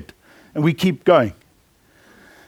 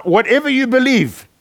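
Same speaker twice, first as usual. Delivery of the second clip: usual speaking voice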